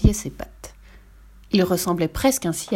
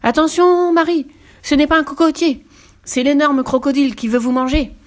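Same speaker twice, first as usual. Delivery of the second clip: much higher and louder yell; hippo's warning shout; 7 semitones higher, 6 dB louder than usual. A narrator's reading. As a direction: bellow a warning across the clearing. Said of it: "hurla Double Croup". (cri d'alarme puissant de l'hippopotame)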